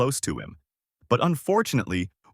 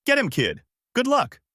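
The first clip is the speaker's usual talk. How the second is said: shouting out; 12 semitones higher than usual. A narrator's reading. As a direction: call it out high while running away. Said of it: "Oolong shouted out as he ran away".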